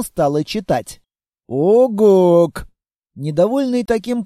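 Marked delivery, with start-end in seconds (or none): 1.5-2.7 a short, loud creature grunt; monster grunt. Acted. The monster grunts at the reading girl. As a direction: bark it like a beast.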